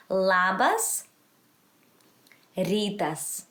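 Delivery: Labas lifted, pause, then rytas falling with rolled R; repeat-after-me model. Shown as a demonstration. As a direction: teach Labas rytas in separate words for repeating. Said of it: "then you repeat after me".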